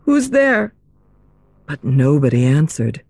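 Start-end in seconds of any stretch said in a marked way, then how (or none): 0.1-0.7 shaky voice. Acted. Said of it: "in a shaky voice asked".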